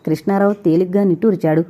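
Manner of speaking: plain reading voice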